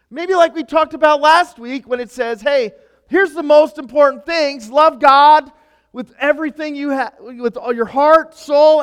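loud, high, impassioned preaching; preaching at full force. Acted. Pastor urging congregation to love God, preaching at full force.

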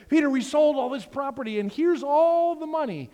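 high, loud and eager; super excited. Retold or imitated. Ananias showing Peter the money, high and excited. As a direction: burst in bragging about the money. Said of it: "he's super excited".

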